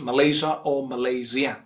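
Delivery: Malaysia as muh-LAY-zhuh, then muh-LAY-zee-uh; two correct variants. Shown as a demonstration. Teacher offering both accepted ways, two variants of Malaysia. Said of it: "pronounced in two different ways".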